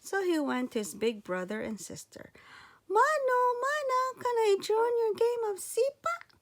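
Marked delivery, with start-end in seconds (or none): 2.9-6.3 put-on high, loud eager little boy voice; a tiny boy pleading to help, pitched high and loud; little boy Sandangao. Child hero voiced in story.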